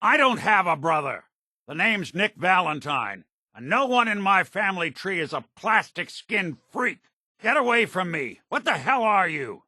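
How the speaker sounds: high-pitched, agitated outburst; angry denial. Acted. Angrily denying having a brother.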